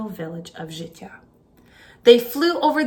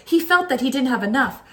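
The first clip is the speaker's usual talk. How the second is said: usual speaking voice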